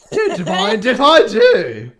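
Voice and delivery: put-on hammy British accent, slow and loud. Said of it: "you should be reading this in a British accent".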